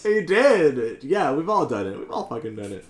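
high, loud, emphatic agreement; worked-up, high-pitched admission; excited agreement. Real, not acted. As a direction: agree loudly, pitch jumping right up. Excited agreement with mock accusation.

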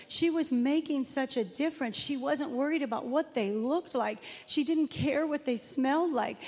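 ordinary talk

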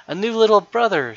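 high, loud burst of delight; little girl thrilled to hear of her new baby brother; wide-eyed wonder. Acted. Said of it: "her eyes wide with wonder".

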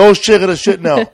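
loud, high cry of sudden realization; urgent exclamation. Retold or imitated. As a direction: blurt it out in mock urgency.

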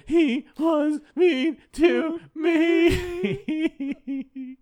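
very high, very slow shout; high, slow mock-tearful complaint to his advisors; whiny Tony. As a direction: wail it like a hurt child. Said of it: "and he's like".

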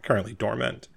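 ordinary talk

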